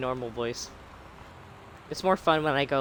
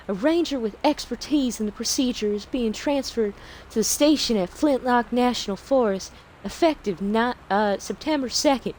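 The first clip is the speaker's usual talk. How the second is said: really bad Southern Belle accent. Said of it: "the really bad Southern Belle".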